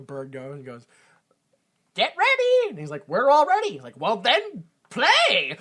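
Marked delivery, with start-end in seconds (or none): 1.9-2.7 very high, loud squawked call; bird voice. Acted. Bird signalling the mouse orchestra, high cartoon call. 3.1-3.7 high, louder cartoon voice; cartoon animal reply. Mouse orchestra answering the bird, high cartoon voice. 4.0-5.6 high call rising to a loud order; cartoon command. Bird cueing the mouse orchestra, high cartoon call.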